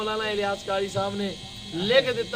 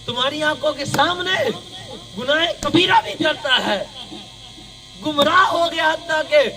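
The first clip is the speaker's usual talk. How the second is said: loud, rising sermon emphasis; forceful preaching; 4 semitones higher, 5 dB louder than usual. Real, not acted. Preacher quoting a ruling on erring sayyids, forceful. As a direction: drive each phrase out to the crowd.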